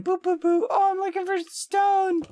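very high mock singsong voice; high-pitched caricature of an unsuspecting victim; imitating Hannah. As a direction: put on a squeaky, oblivious character voice. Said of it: "Hannah's gonna come in".

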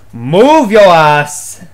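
slow, loud bark at a teammate; loud, slow shout of move your ass; yell. Real, not acted. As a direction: drag out a frustrated yell. Yelling at teammate.

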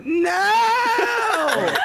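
high laughing yell of no; shouting no. Retold or imitated. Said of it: "she just heard me go".

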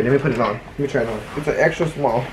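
ordinary delivery

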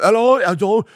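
high, loud mock British accent with a laugh. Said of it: "I met a lot of British people".

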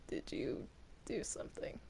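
hushed suspicious whisper. Real, not acted. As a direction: whisper it behind her back. Whispered aside.

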